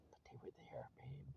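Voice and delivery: breathy whispered plea, barely voiced; a real hushed whisper to his wife. Real, not acted. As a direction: drop to a whisper as if confiding. Pleading with estranged wife.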